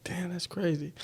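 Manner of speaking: breathy whisper of amazement; whispered inner thought. Retold or imitated. Thinking to himself after hearing it, whispered. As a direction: whisper it to yourself, struck. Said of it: "I'm like".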